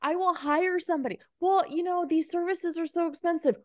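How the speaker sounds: lightly mock the person who says it's too pricey; a hint of a sneering skeptic; mocking a cheapskate. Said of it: "And someone's like".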